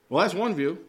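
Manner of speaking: ordinary delivery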